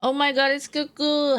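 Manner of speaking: excited greeting. Real, not acted. Greets a newly arriving viewer.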